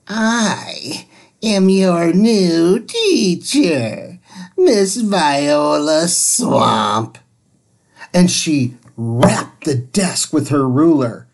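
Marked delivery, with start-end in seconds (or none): 0.1-7.2 very slow, grim and stern; a mean witch announcing herself; witchy teacher. Acted. Miss Swamp introduces herself to the class, slow and stern.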